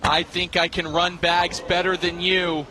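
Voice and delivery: ordinary talk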